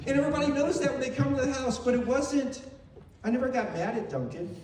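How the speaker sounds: ordinary talk, nothing marked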